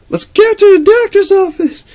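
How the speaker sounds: very high, loud shrill shout; hammed-up frantic mom voice; panicked mom. Panicked mom rushes her son to the doctor, very high shout.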